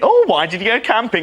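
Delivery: very high chatty mock hairdresser; nattering hairdresser. Bank manager chatting at the till like a nattering hairdresser. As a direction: put on a squeaky-high salon natter. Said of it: "acting like a hairdresser, like, nattering away".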